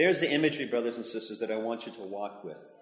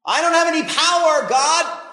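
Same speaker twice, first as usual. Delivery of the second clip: much higher, much louder plea; whining Moses; 15 dB louder than usual. Acted. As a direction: wail your weakness. Moses protests to God.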